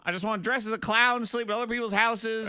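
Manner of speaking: high-pitched pleading, hammed up; comic fed-up whine; mock-desperate plea. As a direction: play a husband desperate to escape. Acting the fed-up ranch owner's rant.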